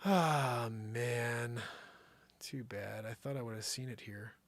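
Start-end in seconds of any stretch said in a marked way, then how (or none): none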